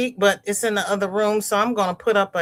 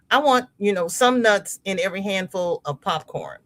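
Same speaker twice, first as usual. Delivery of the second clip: normal talking voice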